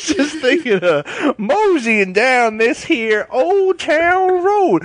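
put-on high, slow old prospector drawl. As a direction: drawl it like a grizzled miner. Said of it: "you're an old prospector".

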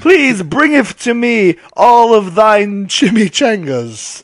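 slow, high, grandly declaimed; Shakespearean English. Pretend. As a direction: declaim like a pompous bard. Mock Shakespearean demand, slow.

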